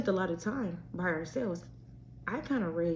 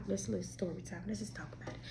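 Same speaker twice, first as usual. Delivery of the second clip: hushed whisper before a story; whispered lead-in; 12 dB quieter than usual. Real, not acted. Whispering to listeners before telling her story. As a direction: drop to a secretive whisper.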